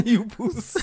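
high, laughing South African echo; South African accent. Retold or imitated. Echoing friend's joke, laughing South African accent. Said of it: "That's not somebody from Brakpan saying this".

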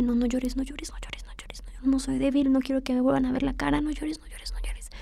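hushed, urgent whisper, repeating to herself; whispered inner voice. Retold or imitated. Fighting back tears in the kitchen, she whispers to herself. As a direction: whisper it under your breath, holding back tears.

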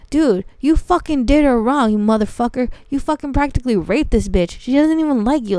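imitating an enraged ex at full volume; loud angry outburst. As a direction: act out the ex yelling accusations. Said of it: "it was so fucking loud".

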